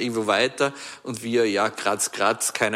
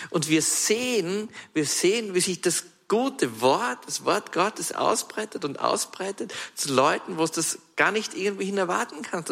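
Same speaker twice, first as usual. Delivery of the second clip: höher, gehobener Predigtton; begeisterte Verkündigung; 5 semitones higher than usual. Acted. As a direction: mit leichter Begeisterung predigen. Preaching lift. (predigt der Gemeinde vom Wort Gottes, leicht gehoben begeistert)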